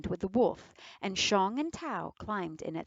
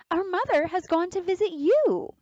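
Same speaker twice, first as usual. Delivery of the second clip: put-on child voice; 11 semitones higher, 7 dB louder than usual. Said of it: "Trying to be tricky".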